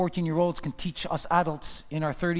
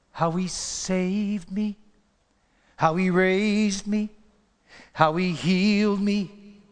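preaching with weight; slow preaching litany; 3 semitones higher, slower than usual. Acted. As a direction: lean into each line.

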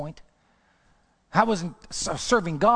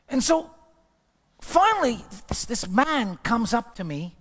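normal talking voice

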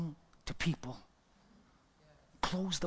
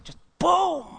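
loud, high burst; boom exclamation; 14 semitones higher, 12 dB louder than usual. Acted. Preacher dramatizing her fall, loud and high. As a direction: punch the boom like a blast. She fell under God's power.

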